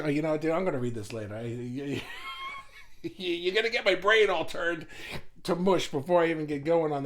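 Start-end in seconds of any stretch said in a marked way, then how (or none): 2.0-3.0 high shrieking laugh. Real, not acted. Overwhelmed by long comment.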